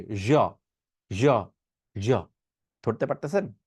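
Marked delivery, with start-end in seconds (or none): 0.0-2.1 জ as "zho", buzzed and lower; ZH with vibration. Shown as a demonstration. Teacher showing learners, vibrating zh on জ. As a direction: add voice to a rounded sh for জ. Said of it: "একটু vibration দিয়ে".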